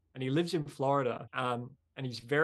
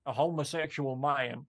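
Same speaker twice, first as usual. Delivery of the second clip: put-on Alabama accent; 3 semitones higher than usual. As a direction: do a Southern Alabama man, raised voice. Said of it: "That is an Alabama accent".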